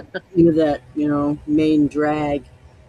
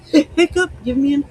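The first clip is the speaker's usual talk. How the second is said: high squeaky voice asking for another shot; high squeaky pretend hiccup voice; 10 semitones higher than usual. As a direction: give the hiccups a needy little voice. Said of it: "It's like".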